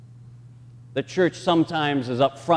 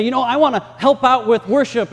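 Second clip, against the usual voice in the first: eager volunteer; 9 semitones higher, 8 dB louder than usual. Retold or imitated. Voicing eager church member.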